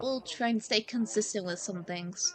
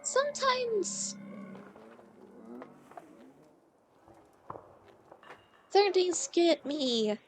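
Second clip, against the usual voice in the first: teary; 6 semitones lower, 4 dB quieter than usual. Real, not acted. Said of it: "if I sound very teary".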